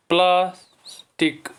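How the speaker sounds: "Plastic" slow, split as pliers, टिक; syllable by syllable. Shown as a demonstration. Teacher asking children to count syllables, syllable by syllable. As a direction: leave a gap inside "plastic" before टिक. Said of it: "इसमें हैं दो syllable".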